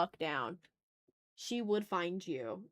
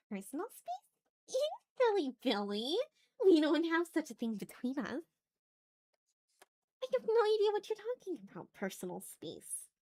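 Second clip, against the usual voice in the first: put-on higher, sing-song voice of a clingy girl teasing playfully; coy yandere girl; 8 semitones higher than usual. Streamer retakes the clingy yandere girl's lines to a viewer, coy and higher. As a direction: play her as a girl who won't hear of personal space.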